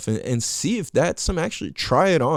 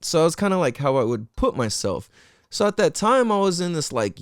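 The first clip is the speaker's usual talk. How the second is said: ordinary talk, nothing marked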